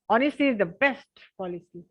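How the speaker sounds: "best" lifted and pressed in Honesty is the best policy; slowly, leaning hard on the word best; slow proverb with best highlighted; stress on best. Shown as a demonstration. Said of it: "best को मैंने क्या किया, highlight किया".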